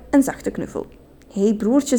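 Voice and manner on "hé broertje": ordinary delivery, nothing marked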